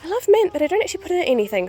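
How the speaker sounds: excited. Real, not acted. Fast, high aside.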